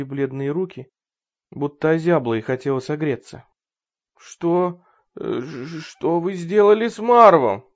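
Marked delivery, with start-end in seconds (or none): 4.2-7.6 frightened stammer. Acted. Terrified woman stammers.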